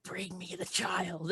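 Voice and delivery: slow, soft eerie ghost. Acted. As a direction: drag each word like a haunting spirit. Ghost speaks.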